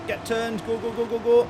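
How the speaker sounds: high, fast go go go; excited urging. Real, not acted. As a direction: urge the car on. Urging car through the gap.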